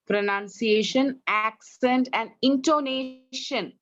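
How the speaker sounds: "Pronunciation, accent, and intonation" slowly, each word distinct; corrective word list. Shown as a demonstration. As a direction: draw out "intonation" as in-to-NAY-shun.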